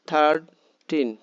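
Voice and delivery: Thirteen slowly, as থার, then টিন; clapped-out syllables. Shown as a demonstration. Teacher models thirteen for clapping, slowly separated. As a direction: lean firmly on each half of thirteen. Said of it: "প্রত্যেকটা হাততালি দেবে".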